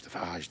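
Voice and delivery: phage with an open "ah" and soft zh; trying out pronunciations. Shown as a demonstration. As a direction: say phage as "fahzh". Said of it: "I don't know what the hell they call it".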